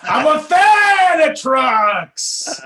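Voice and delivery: drawn-out shouting Pacino; Pacino impression. Continuing the Pacino bit.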